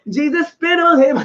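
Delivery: normal talking voice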